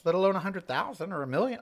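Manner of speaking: ordinary delivery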